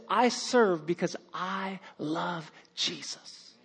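soft, slow and sincere; sincere quote. Retold or imitated. Church member quoting her quiet sincere reason. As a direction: say it simply, from the heart. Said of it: "And she said this".

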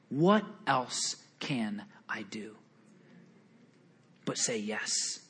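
hushed unvoiced whisper. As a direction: breathe it out like a quiet confession. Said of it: "He said".

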